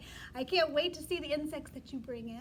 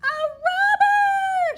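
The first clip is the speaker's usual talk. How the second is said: much higher, loud, slow cry of alarm; shouted alarm; 18 dB louder, much slower than usual. Shown as a demonstration. Teacher leading the class, shouting a robber alarm. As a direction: shout the warning with full alarm. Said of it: "Say it with me".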